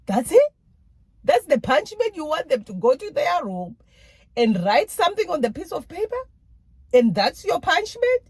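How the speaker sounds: high, loud, disbelieving outburst; incredulous. Retold or imitated. Wife to husband about the kids' punishment, shocked and indignant. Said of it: "I'll look at my husband like".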